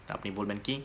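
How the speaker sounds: normal talking voice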